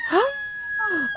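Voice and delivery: sharp high delighted gasp; excited gasp. Real, not acted. Streamer gasping as raiders arrive, excited. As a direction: gasp in surprise as the raid lands.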